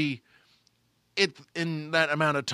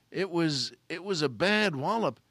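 usual speaking voice

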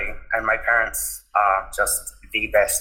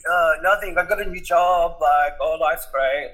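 high, flustered fobbing off; evasive; 7 semitones higher than usual. Retold or imitated. Son dodging dad's question on the phone, flustered and evasive. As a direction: dodge the question, pitched up.

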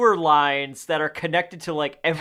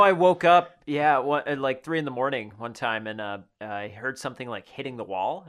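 ordinary delivery

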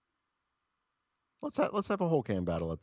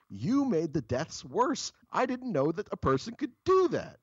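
exasperated scolding; 12 semitones higher than usual. Retold or imitated. Scolding the boss.

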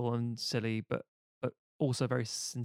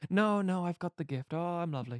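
a higher, lightly voiced innocent entertainer; a hint of a simple, starry-eyed juggler; naive dreamer; 5 semitones higher than usual. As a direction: gush about the vision like a wide-eyed fool. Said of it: "He's a very innocent individual".

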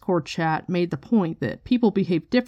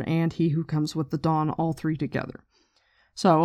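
ordinary talk

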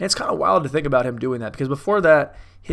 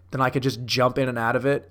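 usual speaking voice

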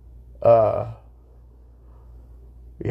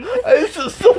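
laughing; 5 dB louder, slower than usual. Real, not acted. Laughing while talking.

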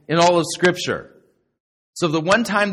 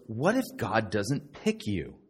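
slower, lower and softer, as if pondering; musing; 6 semitones lower, 6 dB quieter than usual. Retold or imitated. Retelling a preacher thinking aloud in his sermon, musing. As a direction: ruminate aloud, unhurried. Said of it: "was musing, if you would, kind of ruminating".

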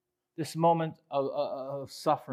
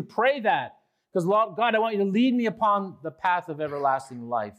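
higher, louder preaching voice, pressing each line; fervent preaching; 6 semitones higher, 3 dB louder than usual. Acted. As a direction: preach it out with full conviction. Preaching with rising fervour.

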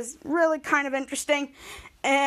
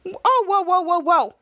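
mock alarmed calls; 5 semitones higher, 5 dB louder than usual. Voicing Ray stopping her.

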